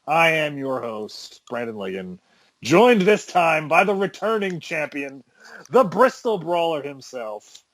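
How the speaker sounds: slow, drawn-out ring announcer introduction; show announcer intro. Acted. Host introducing his returning co-host, announcer style. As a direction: announce the returning champion with big stretched words.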